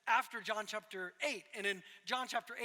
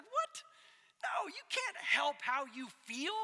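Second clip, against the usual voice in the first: incredulous retort; 4 semitones higher, slower than usual. Retold or imitated. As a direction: shout it back in disbelief. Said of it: "And I'm like".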